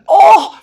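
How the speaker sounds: loud, high groan of frustration; exasperated groan. Real, not acted. Viewer fed up with repetitive readers, exasperated groan. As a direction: burst out with an exasperated "oh". Venting about maddening repetition.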